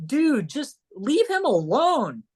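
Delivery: high, drawn-out exasperated plea; exasperated scolding. Retold or imitated. Scolds the pushy fan.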